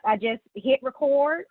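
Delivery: usual speaking voice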